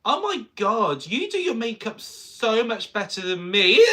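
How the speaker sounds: high, loud, slow gushing fan voice. Retold or imitated. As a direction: gush like an overexcited fan. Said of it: "things you should never say to a drag performer".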